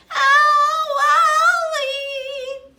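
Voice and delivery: very high, very loud wailing howl; banshee howl. Acted. Girl scratched by the dog, howling very high and loud. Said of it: "the banshee howl she let out".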